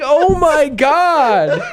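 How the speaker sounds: slow stunned gasp. Retold or imitated. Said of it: "You're like".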